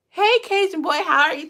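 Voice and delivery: loud, high hello to a viewer; excited shout-out. Real, not acted. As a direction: call out the greeting loudly. Calling out to a live viewer.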